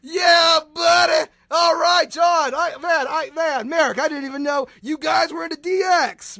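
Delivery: loud, high excited yelling; excited shout. Real, not acted. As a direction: cheer like a crowd going wild. Cheering at the introduction.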